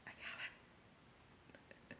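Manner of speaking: very slow, barely audible whisper. Acted. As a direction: let it fade into a drawn-out whisper. Repeats realization as a whisper.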